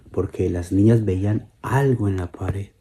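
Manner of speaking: usual speaking voice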